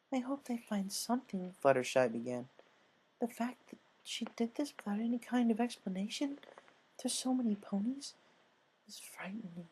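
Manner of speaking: high soft timid voice that fades to a whisper; Fluttershy fading to a whisper. A narrator's reading. Fluttershy telling Rainbow it is frightening, fading to a whisper. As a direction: let the fear steal her voice away.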